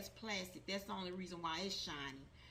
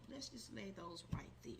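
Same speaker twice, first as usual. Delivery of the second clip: whisper; 7 dB quieter than usual. Real, not acted. Setting cards down.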